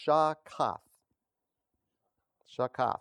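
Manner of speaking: shakaf with the weight on its last syllable; stress on second syllable. Shown as a demonstration. Preacher sounding out the Hebrew word, shakaf stressed on the second syllable. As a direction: land on the "kaf" in shakaf. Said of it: "the emphasis is on the second syllable".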